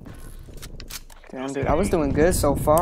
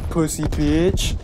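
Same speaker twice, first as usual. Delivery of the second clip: mock taunt; much slower than usual. Real, not acted. Taunting a killed enemy.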